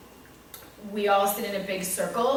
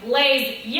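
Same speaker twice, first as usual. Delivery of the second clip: loud, high class reply; loud, mocking-back chant; annoyance reflected back; 5 semitones higher, 9 dB louder than usual. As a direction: imitate kids throwing the teacher's annoyance back. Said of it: "they're reflecting that back".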